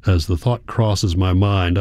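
usual speaking voice

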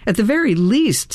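ordinary delivery, nothing marked

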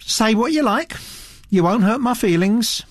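the Duchess holding forth to Mister Satterthwaite, imperious; haughty old Duchess. Acted. Grande dame talking down her cousin's girl, haughty voice.

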